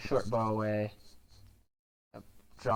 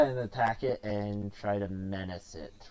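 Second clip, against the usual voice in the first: normal talking voice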